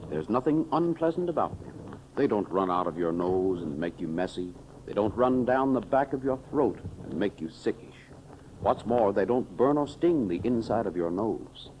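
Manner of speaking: usual speaking voice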